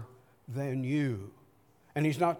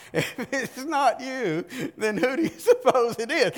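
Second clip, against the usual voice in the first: high, laughing reply; laughing wife; 8 semitones higher, 3 dB louder than usual. Retold or imitated. Wife laughing back at her husband. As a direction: laugh through the wife's teasing answer. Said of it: "And she says".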